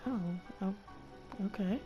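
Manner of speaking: ordinary talk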